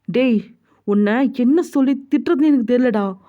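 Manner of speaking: fed-up, heated telling-off; exasperated scolding. Acted. Friend scolding Jungkook for never confessing, exasperated. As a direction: burst out in disgust, then lecture crossly.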